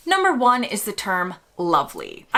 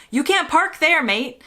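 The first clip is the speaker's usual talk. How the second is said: sarcastic British scold; 7 semitones higher than usual. Retold or imitated. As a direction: tell off a stranger with a snide "mate". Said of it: "used sarcastically".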